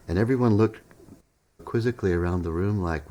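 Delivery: plain reading voice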